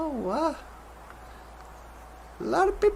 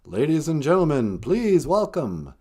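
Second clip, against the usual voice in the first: announcer; 13 semitones lower, 3 dB quieter than usual. Pretend. Introducing guest to stage.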